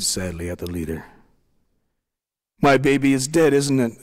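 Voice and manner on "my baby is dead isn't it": higher, louder, a touch of a sob; a mother's anguished question, lightly voiced; sobbing. A narrator's reading. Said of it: "the young woman sobbed".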